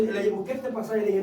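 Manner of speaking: ordinary delivery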